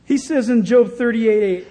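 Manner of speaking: ordinary talk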